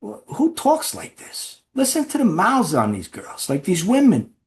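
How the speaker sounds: high, slow mock-offended mobster voice; imitating the mob boss. Mob boss mocking the women on TV, high and offended. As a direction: squeak it out like an outraged old-school mob boss. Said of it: "doesn't even break, he goes".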